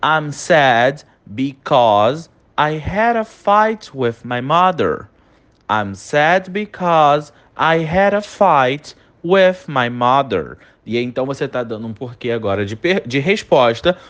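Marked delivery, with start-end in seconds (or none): 0.0-10.6 slow dictation. Shown as a demonstration. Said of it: "E olha aí essa terceira".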